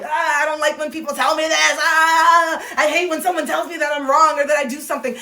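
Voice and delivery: high whine breaking into a loud "Ah"; hammed-up tantrum to let it out; venting frustration. Moving the energy after being corrected, venting frustration. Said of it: "I moved the energy".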